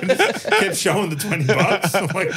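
usual speaking voice

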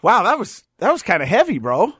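loud, high and animated; excited. Real, not acted. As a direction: burst out with relief. Loud reaction after heavy talk.

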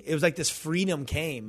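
ordinary delivery

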